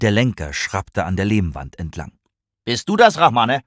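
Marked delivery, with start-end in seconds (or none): 2.6-3.6 sharp, loud, high challenge; stern father's raised-voice question. A narrator's reading. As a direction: call out sharply, catching someone. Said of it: "fragte sein Vater scharf".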